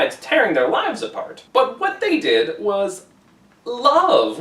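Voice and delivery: usual speaking voice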